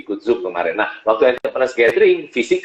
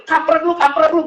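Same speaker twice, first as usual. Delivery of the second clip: much higher, brash mock insult; blunt street greeting. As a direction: imitate a rough blunt businessman. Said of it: "dia bilang".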